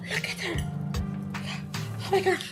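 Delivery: ordinary talk, nothing marked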